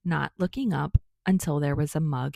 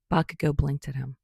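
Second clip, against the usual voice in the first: usual speaking voice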